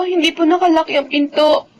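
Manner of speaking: usual speaking voice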